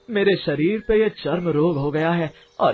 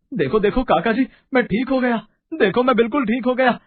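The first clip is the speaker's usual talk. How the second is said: high, elated exclaiming; joyful shouting; 8 semitones higher than usual. Acted. Lala Ram telling Kakaji he is cured, joyful shouting. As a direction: cry out with delight, pitch well up. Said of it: "वो खुशी में चिल्लाने लग जाता है".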